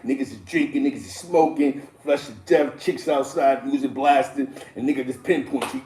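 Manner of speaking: usual speaking voice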